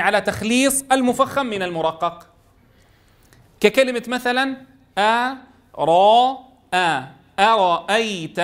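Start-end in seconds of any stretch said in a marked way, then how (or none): 5.0-8.4 أرأيت drawn out syllable by syllable. Shown as a demonstration. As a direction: slowly split أرأيت into its syllables. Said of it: "كتدريب عملي".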